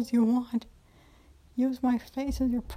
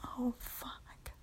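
much softer, a breathless whispered oh; gasped whisper; 13 dB quieter than usual. Acted. Woman overcome as she is touched, whispered gasp. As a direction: let it slip out on a breath. Overwhelmed by pleasure.

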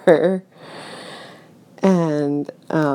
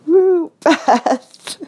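high, louder whooping cheer, breaking into laughter; imitated yell; 13 semitones higher, 6 dB louder than usual. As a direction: imitate a friend's whoop from the audience. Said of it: "someone yelling".

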